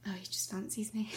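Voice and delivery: mock whisper. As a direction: breathe it out like a secret joke.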